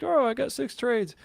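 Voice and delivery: high bragging voice; gloating self-quote. Retold or imitated. Texting his co-host about his wins, bragging in a high voice. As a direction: crow about a winning streak. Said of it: "I'm like".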